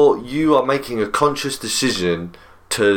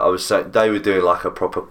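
ordinary talk

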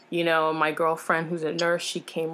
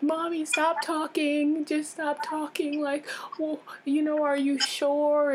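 retold distraught plea; 9 semitones higher than usual. Patient begging mom to stop talking after diagnosis, high retold plea. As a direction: re-enact pleading with mom, voice pitched well up. Retelling panic at cancer news.

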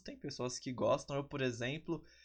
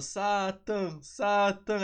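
loud chanted call, pitched up; crowd shouting; 6 semitones higher, 6 dB louder, much slower than usual. Retold or imitated. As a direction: yell the name like a cheering crowd. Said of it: "você ouvir todo mundo gritando".

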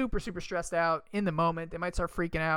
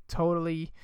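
ordinary talk

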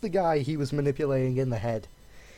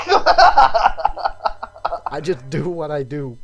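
slow, louder, laughing out the villain's words; laughing through the line; 11 dB louder, much slower than usual. Retold or imitated. Retelling the villain's shrug, laughing through it. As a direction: laugh while quoting him. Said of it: "he just goes".

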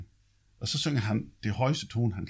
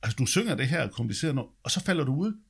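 a lightly voiced amazed conductor, pitched higher; the conductor marvels, voice raised in pitch; imitating the conductor; 9 semitones higher, 4 dB louder, faster than usual. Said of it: "tit sagde min dirigent der".

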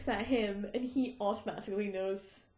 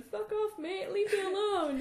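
mock Harry; 8 semitones higher than usual. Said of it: "Harry in the background going".

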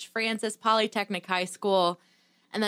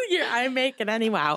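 giggly. Real, not acted. Teasing echo while laughing.

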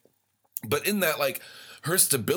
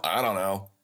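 ordinary talk

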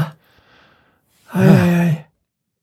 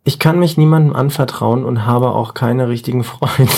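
stifled giggles; 7 semitones lower than usual. Real, not acted. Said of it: "Hey, nicht lachen".

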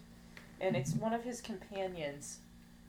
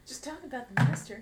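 high, louder mocking repeat; loud mocking echo; 6 semitones higher, 8 dB louder than usual. Repeating the host's line back to tease him, loud and mocking. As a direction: throw the line back, teasing.

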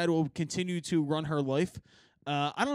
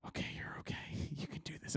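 hushed pep talk; whispered calm-down; whispered self-talk; 3 semitones lower, 15 dB quieter, faster than usual. Retold or imitated. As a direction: whisper to himself. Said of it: "I was like".

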